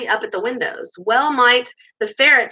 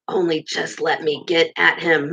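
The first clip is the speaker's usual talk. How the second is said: low angry mutter; pent-up grumble, low-pitched; muttered through teeth; 5 semitones lower than usual. A narrator's reading. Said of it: "muttered Toad, grinding his teeth".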